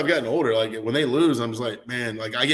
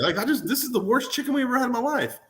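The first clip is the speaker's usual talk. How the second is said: higher, put-on voice griping at the wing; mock disgust; 10 semitones higher than usual. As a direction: whine about the worst wing ever. Mock complaint about chicken wing.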